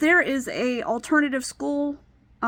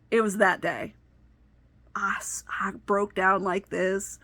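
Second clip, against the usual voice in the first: ordinary talk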